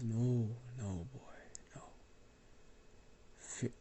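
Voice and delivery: put-on smiling whisper to the boy; soft whisper, reassuring a frightened child; gentle whisper. Old man comforts the boy.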